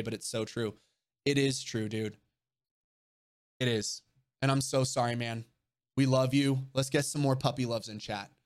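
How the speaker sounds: ordinary talk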